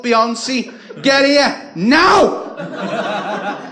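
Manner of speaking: high, loud shrieked roll call of names; yelling for her kids from the street corner; screaming mum. Retold or imitated. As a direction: bawl the kids' names across the street like a frantic mum. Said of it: "She'd be screaming at the top of her lungs".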